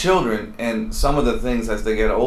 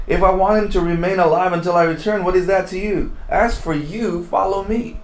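higher, mildly exasperated reading; lightly frustrated Jesus pressing the point; a little frustrated; 7 semitones higher than usual. Shown as a demonstration. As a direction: let some frustration creep into the rebuke. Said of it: "It's a little frustrated".

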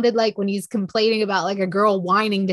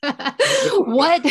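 a laugh breaking into the word; 8 semitones higher than usual. Real, not acted. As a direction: laugh through the question, higher. Laughs while starting a question.

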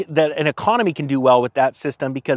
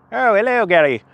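hammed-up, higher Irish greeting; Irish accent; 4 semitones higher than usual. Greeting Irish commenter Gary, bad Irish accent. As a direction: attempt a shaky-at-best Irish accent. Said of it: "is that Irish?".

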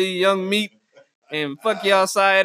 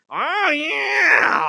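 mock moan again; 7 semitones higher than usual. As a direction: overact a creaky sex moan. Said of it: "like this".

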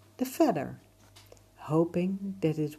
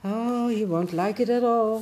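cat voice; 6 semitones higher, 7 dB louder than usual. Acted. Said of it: "thought Emir's cat".

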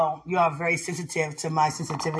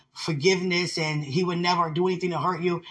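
usual speaking voice

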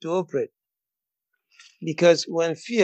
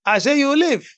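forceful, raised declaration of faith; fervent preaching; 4 semitones higher, 3 dB louder than usual. Real, not acted. Preacher telling listeners they will live, fervent preaching. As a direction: preach it boldly for the amens.

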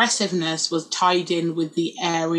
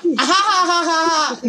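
forced high mock laughter ha ha ha; fake laugh; 7 semitones higher than usual. Sister mocking mum with a forced fake laugh. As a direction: pretend to crack up at your own joke. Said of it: "No one laughs".

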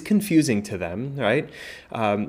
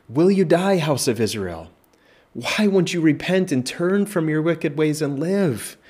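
high, slow, pleading call; God's plea; 7 semitones higher, 4 dB louder than usual. Acted. God pleading with the house of Israel, slow and high. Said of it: "think of God's plea".

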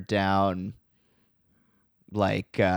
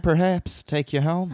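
put-on Southern accent; 7 semitones higher than usual. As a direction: sweet-talk her like a cowboy. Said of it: "like, Arkansas".

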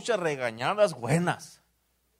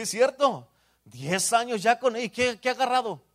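ordinary delivery

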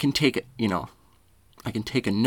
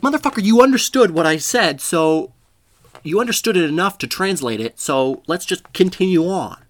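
high, loud comic rant; exasperated retort; 8 semitones higher, 6 dB louder than usual. Acted. Speaker snaps back at a grammar corrector, in loud exasperation. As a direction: fire back loudly, stretching the final phrase.

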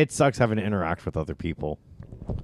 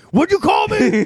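much higher, louder indignant squawk; mock outraged guy; 8 dB louder, slower than usual. Mimicking straight guys' reaction.

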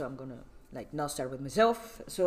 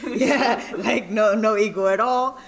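laughing; 5 semitones higher, 7 dB louder, slower than usual. Real, not acted. Laughing off own boast.

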